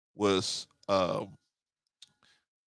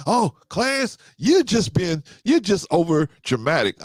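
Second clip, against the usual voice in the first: higher and louder mock girlfriend brushing him off; dismissive girlfriend; 5 semitones higher, 4 dB louder than usual. Him imitating his girlfriend calling him dramatic, higher and louder. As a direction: play her waving him away as dramatic.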